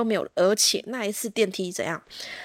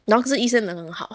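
normal talking voice

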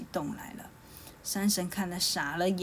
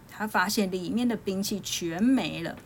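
usual speaking voice

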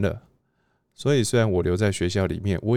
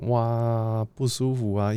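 higher, drawn-out inner groan; uncomfortable dismay; 4 semitones higher, slower than usual. Retold or imitated. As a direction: retell a kid's uneasy "wow", pitched up. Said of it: "心里就觉得". (拉高声音，模仿心里不舒服的感叹)

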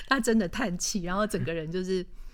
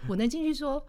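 ordinary talk

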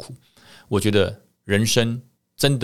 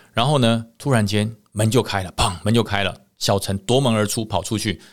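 ordinary delivery, nothing marked

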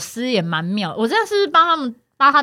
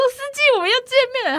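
cheerful greeting; 13 semitones higher, faster than usual. Retold or imitated. Greets same driver again.

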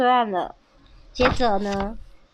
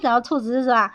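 ordinary delivery

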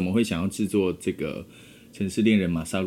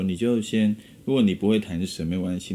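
normal talking voice

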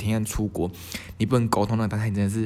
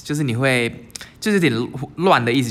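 ordinary talk, nothing marked

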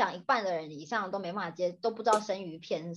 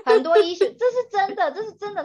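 laughing; 11 semitones higher, 8 dB louder than usual. Real, not acted. Laughing agreement about Turkey.

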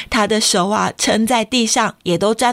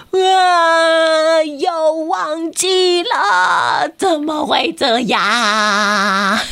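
despairing cry; 5 semitones higher, much slower than usual. Acted. Kenta cries out after forgetting yet again. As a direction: fall apart at forgetting again. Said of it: "健太崩溃大叫".

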